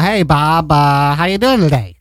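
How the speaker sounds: put-on Boston accent. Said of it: "Boston accent".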